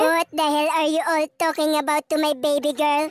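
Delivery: put-on protective boyfriend. Boyfriend confronting the girls, low and forceful. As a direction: come in defending your girl.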